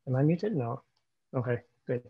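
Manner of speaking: usual speaking voice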